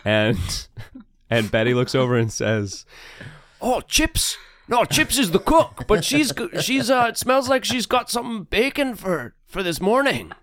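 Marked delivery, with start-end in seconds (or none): 3.6-10.3 high cheerful sailor woman; bright high character voice; Betty's voice. Acted.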